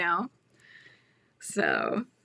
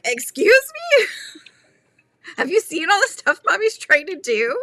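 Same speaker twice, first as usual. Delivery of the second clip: much higher and louder, mock outrage; mock offended mom; 9 semitones higher, 6 dB louder than usual. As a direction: squeal it like an insulted mom, then laugh. Mom retorts to son calling her lazy.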